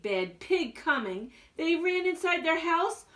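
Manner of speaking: ordinary delivery, nothing marked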